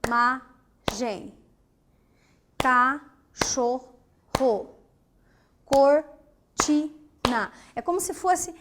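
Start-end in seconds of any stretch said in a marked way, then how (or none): 0.1-1.3 Imagem chanted slowly as i, ma, gem; syllable-by-syllable split. Shown as a demonstration. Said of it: "Você vai separando por sílabas". 2.6-3.9 Cachorro slowly as ca, chor, ro; syllable-by-syllable split. Shown as a demonstration. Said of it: "Você vai separando por sílabas". 5.7-7.5 Cortina very slow and loud as cor, ti, na; syllable-by-syllable split. Shown as a demonstration. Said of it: "Você vai separando por sílabas".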